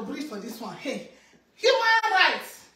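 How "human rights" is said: proclaimed. Shown as a demonstration. Repeats, bigger, after a breath.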